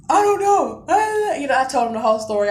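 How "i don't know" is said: high, loud tearful wail; hammed-up helpless cry. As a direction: re-enact bawling to brother. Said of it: "I'm crying and shit".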